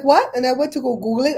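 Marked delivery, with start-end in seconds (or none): 0.0-0.3 mock shocked, high and loud; a retold jolt of disbelief; a burst of disbelief at the news; shocked what. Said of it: "I'm like".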